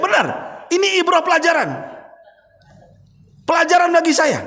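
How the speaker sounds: impassioned sermon peak; fiery preaching. Acted. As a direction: drive the lesson home to the congregation. Preaching at full force.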